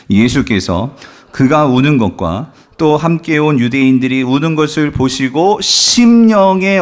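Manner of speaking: loud, slow congregational reading; scripture reading. Acted. Preacher leading the congregation in reading verse thirty-three, loud scripture reading. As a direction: read the verse out slowly and loudly.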